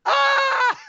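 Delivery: high, shrieked ah; mock scream. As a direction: scream like someone running from a golf cart. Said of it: "Sammy Guevara going".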